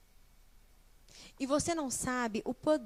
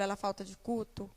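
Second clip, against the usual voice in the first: ordinary delivery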